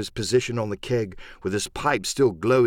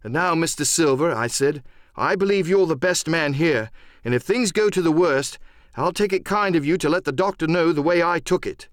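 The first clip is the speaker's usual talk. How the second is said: earnest, higher-pitched appeal; worked-up but courteous lad; breaking out again; 6 semitones higher than usual. Acted. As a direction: press on boldly with the speech. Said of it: "I broke out again".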